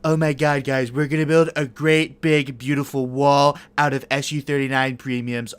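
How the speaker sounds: lightly voiced Trump bluster; a hint of Trump showmanship; Trump impression. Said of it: "I'm gonna do the Trump impression".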